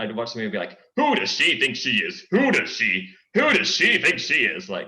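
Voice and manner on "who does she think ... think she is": loud, slow, booming cartoon show-off; Gaston impression. Shown as a demonstration. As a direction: bellow it out as Gaston. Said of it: "that's how I got my Gaston voice".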